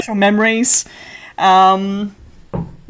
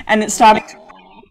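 normal talking voice

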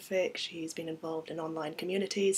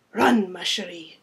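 put-on loud French-accented urging; honeyed French accent, projected; rich French accent; 9 dB louder than usual. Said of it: "with a rich French accent".

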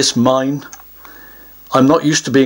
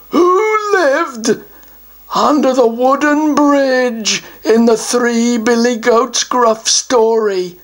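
pompous, higher troll voice; a self-important troll puffing himself up; troll guardian; 6 semitones higher, much slower than usual. Acted. Troll announces he guards the treasure, pompous higher voice.

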